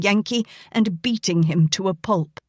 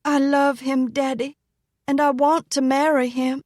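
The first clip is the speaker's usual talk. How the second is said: pleading daughter; 6 semitones higher than usual. Acted. Daughter pleading with her father.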